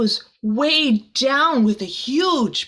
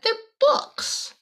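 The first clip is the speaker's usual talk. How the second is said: princess voice. Acted. Princess's disappointed retort.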